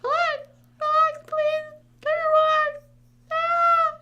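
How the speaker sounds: very high squeaky mock voice; squeaky mock plea. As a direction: squeak at viewers to relax, hammed up. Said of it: "And I'm like".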